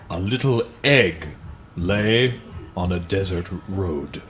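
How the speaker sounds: slow, deliberate picture-book read-aloud; lingering on each line like bedtime reading; storybook narration. Acted. Reading a parody children's book to friends, in a slow storybook voice.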